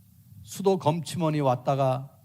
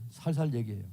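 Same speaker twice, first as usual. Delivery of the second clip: much softer, tender demonstration; soft, gentle talking the way he advises; gently; 4 semitones lower, 8 dB quieter, faster than usual. Shown as a demonstration. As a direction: speak it softly like soothing someone. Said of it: "조곤조곤 얘기해요".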